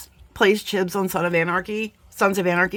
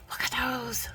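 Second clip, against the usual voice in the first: gasp, then a breathy whisper; awed whisper; 4 semitones higher, 10 dB quieter than usual. Real, not acted. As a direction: whisper it in hushed wonder. Hushed awe after dropping the booms.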